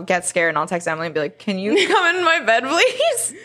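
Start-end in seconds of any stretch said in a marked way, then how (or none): 1.4-3.3 high-pitched imitation of begging; pleading. Scared roommate asking to share Emily's bed, high playful plea. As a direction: plead sweetly, rising high on "please".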